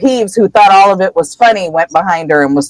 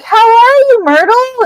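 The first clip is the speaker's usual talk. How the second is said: very high, fake-cheery greeting; forced upbeat. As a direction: overdo the cheer to cover up awkwardness. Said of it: "trying to be all upbeat".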